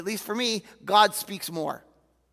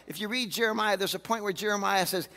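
normal talking voice

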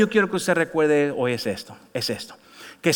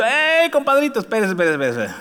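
1 calling out to the waiter; 4 semitones higher than usual. Retold or imitated. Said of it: "Digo yo".

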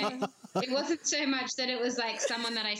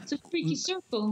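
a shaky attempt at a New Zealand accent, a bit higher; failed Korg accent; 5 semitones higher than usual. Quoting Korg to friends, a half-hearted New Zealand accent. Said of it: "I can't do that accent".

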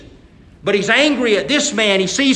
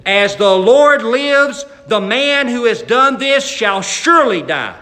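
loud, very slow, higher oath; furious oath; 5 semitones higher, 6 dB louder, slower than usual. Acted. David condemning the rich man, loud with fury. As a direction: roar it out in outrage. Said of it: "he's so mad he can't stand it".

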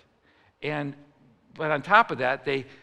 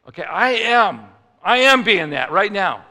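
louder, higher indignant reply; insistent protest; 3 semitones higher, 8 dB louder than usual. Acted. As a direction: push back at the command, insisting. Acted reply to God's command.

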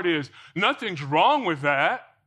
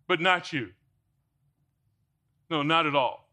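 ordinary delivery

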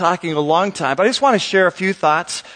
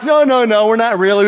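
laughing reassurance; 4 semitones higher, 4 dB louder than usual. Retold or imitated. Reassuring the panicked waitress.

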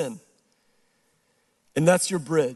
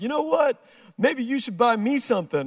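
higher-pitched mock sassy woman; sassy comeback; 7 semitones higher than usual. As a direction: give some lip back, pitched up. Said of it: "giving me lip".

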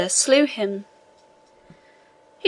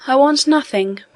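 mildly surprised, pitched higher; a touch of surprise, lifted in pitch; air of surprise; 5 semitones higher than usual. A narrator's reading. Abbé tells the inspector he wants nothing, mildly surprised. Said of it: "with an air of surprise".

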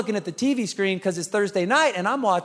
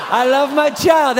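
sweet public parent; 5 semitones higher, 6 dB louder, slower than usual. Retold or imitated. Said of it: "We're like".

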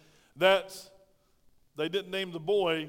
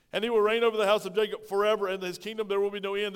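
louder, faster, rising proclamation; preaching at full force; 3 semitones higher, 6 dB louder, faster than usual. Acted. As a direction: proclaim it to the church.

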